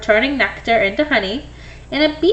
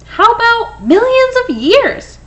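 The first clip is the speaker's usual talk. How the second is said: excited question; 10 semitones higher, 4 dB louder than usual. Acted. Eager pitch for bees.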